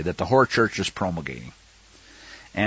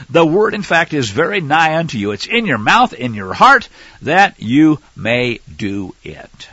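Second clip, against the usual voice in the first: raised, emphatic reading voice; scripture reading; 2 semitones higher, 4 dB louder than usual. Acted. Preacher reading Deuteronomy aloud, emphatic. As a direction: proclaim the verse to the flock.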